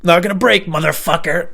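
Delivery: retold drunk defiance with a gun to his head; defiant yell. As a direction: spit it out refusing to break. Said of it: "and I was like".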